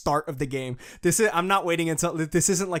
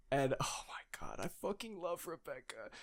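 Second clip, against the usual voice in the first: breathless whisper; 13 dB quieter than usual. Real, not acted. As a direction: whisper it like a secret crush. Gushing aside about Rebecca.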